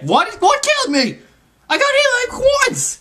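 very high indignant squeal; outraged shriek. Real, not acted. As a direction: squeal in disbelief. Sudden death in game.

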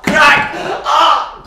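sharp high yelp as the blow lands; cry of pain. Acted. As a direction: shout out at the crack. Child hit on the head, cries out.

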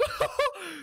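squealing burst of laughter; real giddy laugh; high excited laugh. Real, not acted. Laughing after winning.

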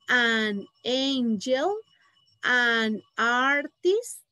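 "an angel" and "an artist" drawn out slowly; slow pronunciation model for the class; slow model words. Shown as a demonstration. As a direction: say each noun slowly and clearly.

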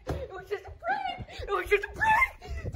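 high, slow, laughing yell; laughing plea. Real, not acted. Begging the chasing owner, laughing. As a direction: plead through laughter.

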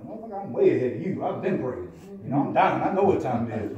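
dying man answering Pico's call to pray, loudly; defiant retort. Retold or imitated. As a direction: shoot back, annoyed and loud. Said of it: "And I'm like".

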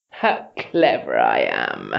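low, hissing monster gloating to herself; self-satisfied hiss. A narrator's reading. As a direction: hiss it smugly under the voice. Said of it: "she hissed to herself".

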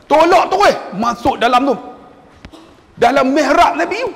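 urgent, high instructions; called-out orders. Retold or imitated. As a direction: call out to the wheelchair pushers. Calling orders to wheelchair pushers.

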